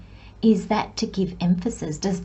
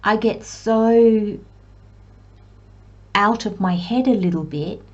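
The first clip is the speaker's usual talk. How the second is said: ordinary talk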